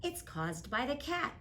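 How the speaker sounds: ordinary delivery, nothing marked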